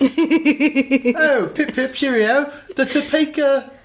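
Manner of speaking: high, piping mock-British voice; British caricature in falsetto; British accent. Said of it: "should we do it in a British accent?".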